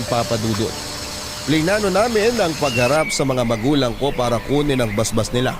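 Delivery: normal talking voice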